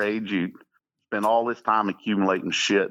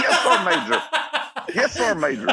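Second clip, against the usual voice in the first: laughing soldier reply; faster than usual. Retold or imitated.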